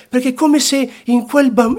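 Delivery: usual speaking voice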